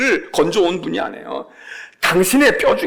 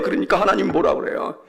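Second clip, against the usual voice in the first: normal talking voice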